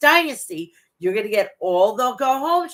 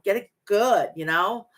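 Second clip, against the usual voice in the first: usual speaking voice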